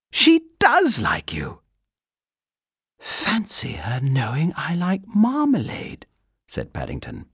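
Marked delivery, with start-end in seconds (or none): 0.1-1.6 put-on slow, loud, high child's voice; triumphant girl. A narrator's reading. Girl telling the bear he is liked, triumphant. 3.0-6.0 high, breathy wonder; marvelling bear. A narrator's reading. Bear amazed she knows about marmalade, marvelling.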